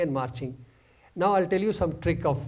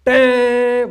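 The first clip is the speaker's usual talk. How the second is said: very loud, high held "tang"; imitated band note; 7 semitones higher, 12 dB louder than usual. Bandsman showing the one note that came, imitated blaring note. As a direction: blare it like a trumpet. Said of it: "one note came".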